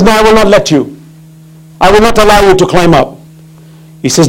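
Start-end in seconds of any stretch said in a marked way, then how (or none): 0.0-3.2 high, insistent refusal; emphatic denial in a raised pitch; acted firm refusal. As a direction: play a ruler refusing outright.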